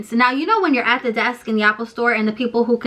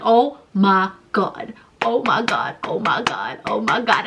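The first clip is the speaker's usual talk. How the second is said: dismayed disbelief; 5 semitones lower, slower than usual. Retold or imitated. Reacting to his text to his girlfriend, sinking dismay. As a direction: let each oh my God sink lower in defeat.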